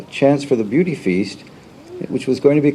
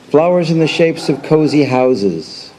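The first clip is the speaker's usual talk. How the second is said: slow, higher-pitched opening line of a poem; poetry reading; 5 semitones higher, slower than usual. Acted. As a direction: recite it slowly, raised and deliberate.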